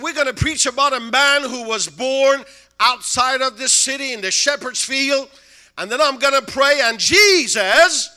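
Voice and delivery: loud, high preacher's call; preaching at full force. Retold or imitated. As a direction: preach it to the whole hall.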